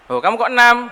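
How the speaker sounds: high, loud mock surprise. Preacher acting an adult teasing a child, mock surprised.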